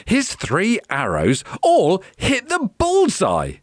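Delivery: usual speaking voice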